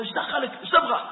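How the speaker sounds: brushing off the greeter rudely; mock hostile retort. Angry shopkeeper snapping at the man who greets him, hostile.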